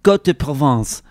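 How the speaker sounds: Côte de Provence in a French accent. Shown as a demonstration. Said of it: "so convincingly".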